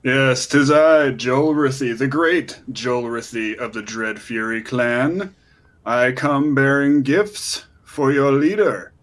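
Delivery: stately, self-important goblin hero; grand proclamation. Acted. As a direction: present yourself pompously to the guards. Hero announces himself to the goblins.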